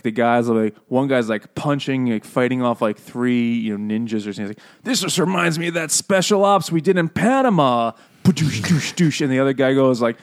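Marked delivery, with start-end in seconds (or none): 4.8-7.9 higher-pitched mock movie hero mid-fight; eighties action hero. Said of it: "action movie conversations from the eighties". 8.2-9.2 higher-pitched mouth-made punches; imitated punch sounds. Imitates punches in movie fight.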